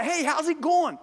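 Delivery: hammed-up friendly shout from a window; calling out. Someone at a tenth-floor window yells to the falling man, mock call-out. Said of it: "they yell out".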